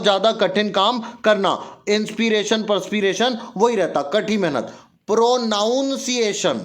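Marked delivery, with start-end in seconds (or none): 5.1-6.6 प्रोनाउंसिएशन slowly, with "noun" inside; slow "ow" vowel in प्रोनाउंसिएशन; wrong pronunciation. Shown as a demonstration. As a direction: say प्रोनाउंसिएशन as "pro-noun-ciation". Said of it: "प्रोनाउंसिएशन नहीं होगा".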